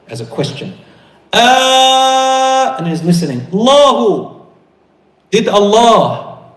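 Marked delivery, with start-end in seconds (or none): 1.3-2.8 high, loud, held cry; shouted cry. Acted. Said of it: "it's when she's shouting". 3.5-6.5 high, loud, slow call; shouted question. Acted. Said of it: "it's when she's shouting".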